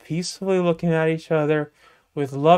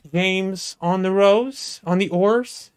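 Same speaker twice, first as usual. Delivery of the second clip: ordinary delivery, nothing marked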